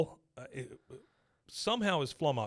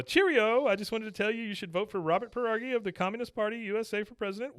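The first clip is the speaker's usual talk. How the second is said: much higher mock British puppet voice; silly puppet voice; 8 semitones higher than usual. Penguin puppet urging Hank to vote communist, high silly voice. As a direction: play the cheerful penguin puppet.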